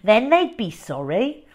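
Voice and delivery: plain reading voice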